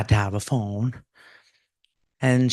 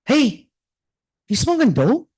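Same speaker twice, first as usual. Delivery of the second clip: high suspicious accusing question; mock father's suspicion; suspicious dad; 12 semitones higher than usual. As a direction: ask if she's smoking, high.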